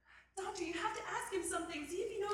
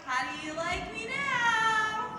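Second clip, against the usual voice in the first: slow drawl; 4 semitones higher, 7 dB louder, much slower than usual. Acted. Slow showy exchange.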